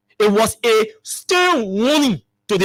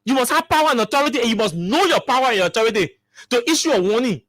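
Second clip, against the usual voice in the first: usual speaking voice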